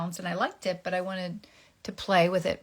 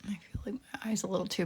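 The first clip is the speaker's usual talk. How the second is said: whispering; 2 semitones higher, 4 dB quieter, faster than usual. Real, not acted. Whispered aside.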